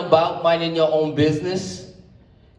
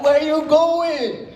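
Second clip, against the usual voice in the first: higher, slow mock yell across the street; mock scream; 8 semitones higher, much slower than usual. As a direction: call out high and drawn out. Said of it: "She screamed out".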